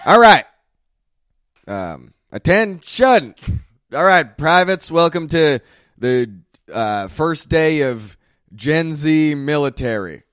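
barking orders at new recruits; loud commanding army instructor; drill sergeant. Acted. Drill sergeant addressing privates.

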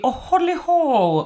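high, slow jolly sing-song; high, drawn-out mock jolly voice; hoddly ho voice. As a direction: put on a silly jolly voice. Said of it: "I don't want to be completely".

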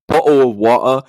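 loud, exaggerated British accent. Shown as a demonstration. Insisting every American has said it, mock British accent. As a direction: slip into mock British, swallowing the t's. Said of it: "in a British accent".

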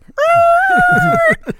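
long loud wordless yell; drill instructor roar. Retold or imitated. Instructor answers a recruit's "Yes, Sarge", roaring wordlessly. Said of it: "why is his voice like that?".

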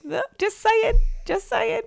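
high, squeaky playful protest; squeaky high teasing. Real, not acted. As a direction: squeak it out innocently.

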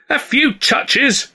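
high, slow incredulous yell. A narrator's reading. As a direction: drag it out in angry disbelief. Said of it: "Stan yelled".

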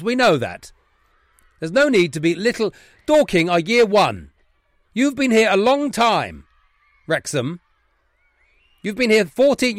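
loud, high, emphatic dressing-down; indignant headmaster. Acted. Headmaster shaming two bullies from Wrexham, indignant. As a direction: lecture them hard, pitch climbing on each charge. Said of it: "One is the Wrexham Headmaster's Office".

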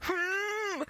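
high, soft squeaky hum; a tiny starstruck whimper; choked-up little squeak; tiny overwhelmed whimper. Retold or imitated. Overwhelmed by the star's praise.

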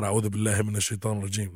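a hurried recitation; fast recitation. Shown as a demonstration. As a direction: rattle it off as your weapon. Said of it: "'Cause I got".

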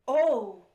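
sharp gasp into a loud "oh"; mock a scandalized gasp; imitated shocked gasp. Host imitating the shocked old couple, mock gasp. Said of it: "the little old lady went".

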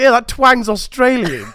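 high, loud and slow; loud mock reply. Imitating Diane correcting Max, high and loud. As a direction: declare it with a grin. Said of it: "And I was like".

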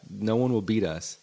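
usual speaking voice

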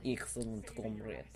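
low, soft mock-Russian accent. Commentator keeping up the bit for co-hosts, Russian accent. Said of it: "I gotta stop it now".

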